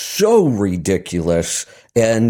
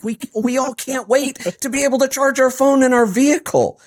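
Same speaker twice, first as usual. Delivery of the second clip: much higher, gushing mock excitement; mock excited voice; 14 semitones higher than usual. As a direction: overdo the thrill, like a kids' show host. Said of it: "in this really excited voice".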